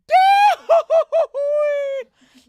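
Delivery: cartoon falling holler, very high and loud; big cartoon yell as he falls; Goofy falling yell. Shown as a demonstration. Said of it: "like, falling Goofy".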